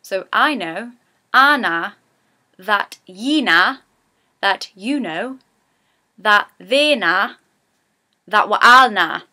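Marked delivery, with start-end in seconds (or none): 1.3-9.2 slow Geordie, each na rising then falling; slow Geordie sentence. Shown as a demonstration. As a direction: spell out the Geordie na sentence slowly. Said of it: "I like to use the sentence".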